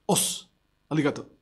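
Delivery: a clipped Japanese "os, arigato"; Japanese words. Retold or imitated. Preacher saying the Japanese acknowledgment. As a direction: say it like a dojo greeting. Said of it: "En japonés decimos".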